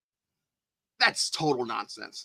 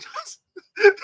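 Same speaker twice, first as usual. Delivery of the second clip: very high, squeaky laughing voice; helpless laughing; 5 dB louder than usual. Real, not acted. Dismissing the comments as nonsense, laughing. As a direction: break up laughing mid-sentence.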